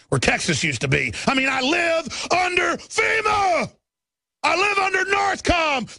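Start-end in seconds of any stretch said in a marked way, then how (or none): 1.3-6.0 high shouted protest; high furious declaration; indignant shout. Real, not acted. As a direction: yell it in outrage. Indignant rant.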